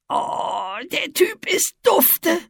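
high, breathy sniffing little monster. A narrator's reading. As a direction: sniff it out like a delighted creature. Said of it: "schnüffelte Etze". (schnüffelnde Monsterstimme)

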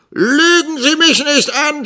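very high, loud accusation; screaming. A narrator's reading. Schoolmaster accusing his assistant of lying, screaming. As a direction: scream it at the liar. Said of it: "schrie Squeers".

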